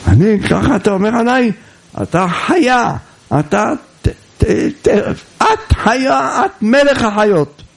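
high, indignant comeback with stammering; acted shouting match. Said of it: "וכל הלילה ממטירים צעקות". (כעס משוחק, גבוה ומגמגם)

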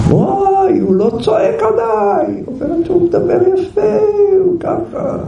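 high, delighted cry of relief; relieved joy. Retold or imitated. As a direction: burst out happily, voice way up. Wife relieved, husband speaks nicely.

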